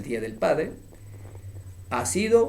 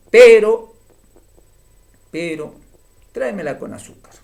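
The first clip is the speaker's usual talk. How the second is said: a loud first "pero", a pause, then "tráemela con azúcar" a touch faster; suspenseful pause; 6 semitones higher, 13 dB louder than usual. Shown as a demonstration. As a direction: hold back, then add the request. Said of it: "Hay un cierto estado justamente de suspenso". (pausa de suspenso tras un "pero" fuerte)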